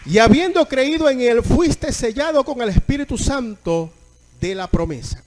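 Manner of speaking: loud, ringing pulpit proclamation; preaching at full force. Acted. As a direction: proclaim the verse to the whole church. (predicación a toda voz, proclamando el versículo)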